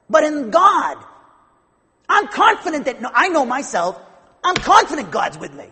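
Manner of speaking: loud, high-pitched preacher's proclamation; impassioned preaching. Real, not acted. Preacher declaring confidence in God to the congregation, impassioned full-force preaching. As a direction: preach it forcefully, punching the key words.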